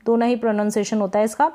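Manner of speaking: normal talking voice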